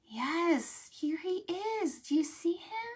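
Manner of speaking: usual speaking voice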